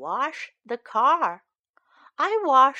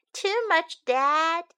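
put-on child asking; 6 semitones higher, slower than usual. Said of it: "I ask".

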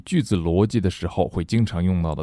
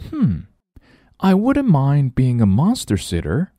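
put-on musing girl; 7 semitones higher than usual. Nelly musing, high.

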